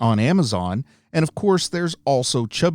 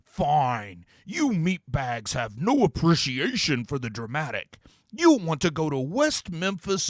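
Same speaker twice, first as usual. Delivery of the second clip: lofty, higher demon voice with odd timbre; 4 semitones higher than usual. Acted. Demon to the heroes, pronouncing a dramatic prophecy. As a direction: intone it like a grand, theatrical demon. Demon grudgingly gives directions.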